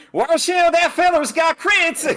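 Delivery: high, loud, slow western announcer shout; Fistful of Frags announcer. Retold or imitated. Imitating a favorite game announcer, a shouted western call. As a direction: holler the warning like an old-west announcer. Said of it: "my favorite announcer is the Fistful of Frags one".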